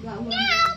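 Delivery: very high meow; imitated cat meow. Prompting child with the cat's sound, high meow. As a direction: mew high like a kitten.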